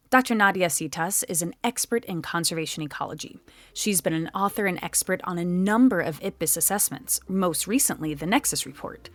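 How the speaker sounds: lively, emphatic guest introduction; podcast presenter read. Acted. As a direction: introduce the expert like a polished radio host. Host's scripted guest introduction.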